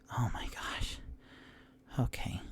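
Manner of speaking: breathless startled whisper; a gnome whispering in shock. Acted. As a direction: hush it like you're afraid to be heard.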